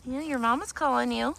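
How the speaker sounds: high soft coo. Real, not acted. Talking to a goat kid.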